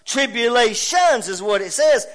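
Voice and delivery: loud, rising preacher's cadence, words hammered out; preaching at full force. Acted. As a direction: preach it to the whole house.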